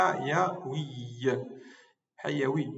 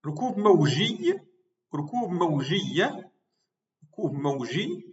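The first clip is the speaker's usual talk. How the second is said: slowed demo; slower than usual. Shown as a demonstration. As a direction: say rukoub mawji slowly and clearly. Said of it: "rukoub al-amwaji". (rukoub mawji dit lentement)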